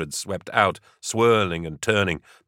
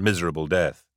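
ordinary delivery, nothing marked

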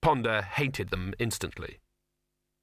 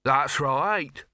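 snapped; 3 semitones higher, 5 dB louder than usual. A narrator's reading. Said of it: "snapped a second woman".

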